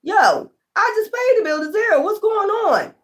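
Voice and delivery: high-pitched bewildered complaint; calling the company, high and bewildered; exasperated caller. Retold or imitated. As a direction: squeak it out like a fed-up caller. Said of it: "be calling them and be like".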